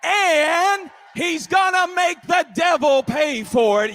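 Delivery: high, loud preacher's shout; full-force preaching. Real, not acted. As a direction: shout the promise to the crowd.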